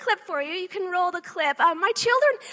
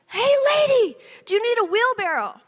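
mock yell, higher and louder, a stranger calling across a lot; retold yell; 4 semitones higher, 7 dB louder than usual. Mother retelling a stranger's jeer, mock yelled. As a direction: belt it out like a heckler far across the lot. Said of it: "yells across the parking lot".

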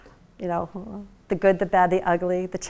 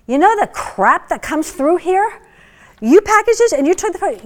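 excited praise; 8 semitones higher, 8 dB louder than usual. Retold or imitated. Buyer praising the inventor's packaging, loud excited rave. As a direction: rave about it in disbelief.

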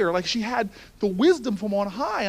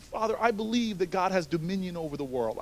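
ordinary delivery

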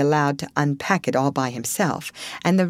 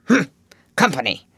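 grunted; 3 dB louder than usual. A narrator's reading. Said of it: "then grunted".

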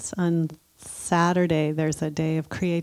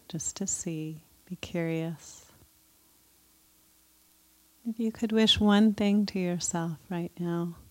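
guided meditation; 2 semitones higher than usual. Acted. Leads a loving kindness meditation.

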